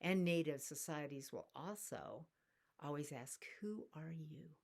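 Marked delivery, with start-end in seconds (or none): 3.6-4.6 whispered question. Acted. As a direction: breathe the question, unvoiced. Native societies asking, acted quote.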